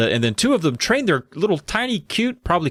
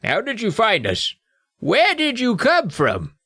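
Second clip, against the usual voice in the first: high, slow gnome voice, demanding; younger gnome demanding; 9 semitones higher, slower than usual. Acted. Young gnome questions the girls, squeaky demand. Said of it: "I mixed up the voices with the older and the younger".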